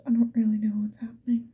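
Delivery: ordinary talk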